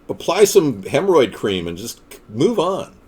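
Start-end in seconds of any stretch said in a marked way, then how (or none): none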